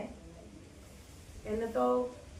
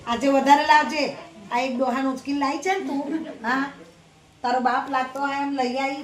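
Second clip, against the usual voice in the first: nagging scold; 3 semitones higher than usual. Acted. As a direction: nag and mock her as she leaves. Mother-in-law orders and mocks.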